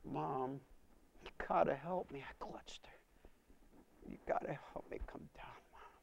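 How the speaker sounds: soft, breaking beg for help; desperate plea. Retold or imitated. Frightened son begs his mother for help, soft desperate plea. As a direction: plead with her quietly.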